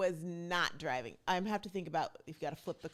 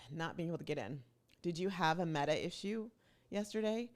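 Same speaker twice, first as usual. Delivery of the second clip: usual speaking voice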